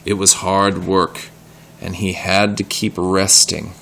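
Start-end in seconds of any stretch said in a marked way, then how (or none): none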